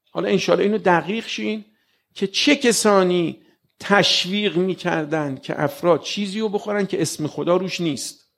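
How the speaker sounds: ordinary talk, nothing marked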